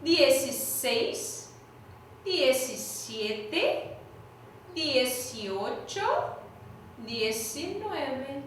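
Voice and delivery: "dieciséis" to "diecinueve" drawn out very slowly, syllable by syllable; slow teaching count. Shown as a demonstration. Teacher reading numbers slowly for students. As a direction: spell each number out slowly for the class. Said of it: "Vamos voltar?".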